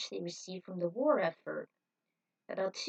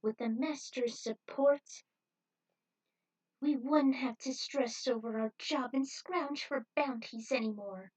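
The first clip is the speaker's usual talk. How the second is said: deliriously happy; 5 semitones higher than usual. Acted. Judy answers Punch on air, dreamily happy and higher. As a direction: sound giddily brainwashed. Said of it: "dazed but deliriously happy".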